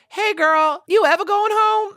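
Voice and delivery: put-on high-pitched Southern accent on a quote; retold Southern accent. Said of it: "you said that with an accent".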